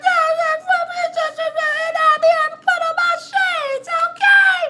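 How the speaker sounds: very high, far-off put-on voice; sing-song falsetto showing off shades; high TV persona. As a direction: squeak it like a TV star. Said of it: "I'm gonna be like".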